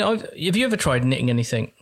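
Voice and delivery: usual speaking voice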